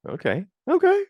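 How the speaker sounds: high, loud rising okays; playful high-pitched approval; impressed approval. Retold or imitated. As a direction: warm to the idea, pitch climbing way up. Acting out approval of the cast.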